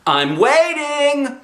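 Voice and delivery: impatient mother drawing it out; shouted. A narrator's reading. Mother shouting impatiently for the tool belt. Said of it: "shouted Mother".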